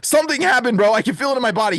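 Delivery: high, agitated imitation; mock panic. Shaken man to the one praying, acted panic. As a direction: play the shaken man reporting what he felt.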